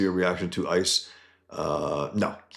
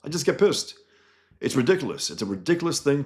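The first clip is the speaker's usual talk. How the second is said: pissed off; 6 semitones higher, faster than usual. Real, not acted. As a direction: let the anger spike. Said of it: "I get pissed off at that".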